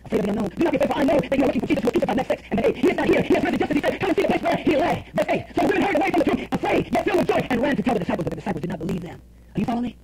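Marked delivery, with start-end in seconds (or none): 0.1-8.2 raised-pitch, urgent Bible storytelling; impassioned pulpit retelling; full-force preaching. Acted. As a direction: preach the story with rising fervour.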